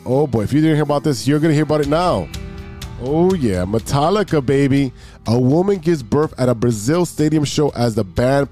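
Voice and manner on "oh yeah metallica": usual speaking voice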